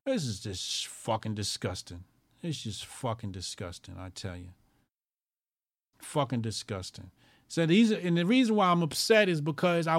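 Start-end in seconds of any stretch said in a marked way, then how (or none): 0.1-7.1 low disgust. Real, not acted.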